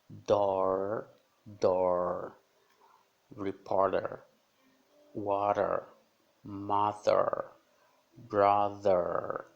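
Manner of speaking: door, water, brother with a loud curled R; strong American R. Shown as a demonstration. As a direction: curl the R hard, American style. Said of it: "som muito forte no inglês americano". (R americano bem forte)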